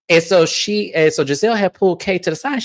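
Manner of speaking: ordinary talk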